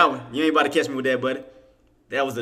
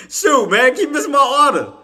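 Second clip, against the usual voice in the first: higher, louder frustrated complaint; frustrated outburst; 11 semitones higher, 6 dB louder than usual. Real, not acted. To the screen, annoyed outburst. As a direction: vent at the missed fill.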